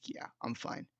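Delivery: a near-silent breathy whisper, barely there; whispered to himself. Retold or imitated. After the breakup, telling himself he's fine in a whisper. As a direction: hush it like convincing yourself.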